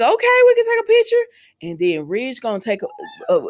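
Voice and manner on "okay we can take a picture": much higher, sing-song imitation of them agreeing; mock high agreeable voice. Retelling a soap couple's photo request, mock high chirp. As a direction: squeak it out like a chirpy yes. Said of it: "And they were like".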